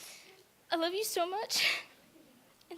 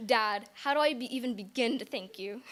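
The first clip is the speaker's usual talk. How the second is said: normal talking voice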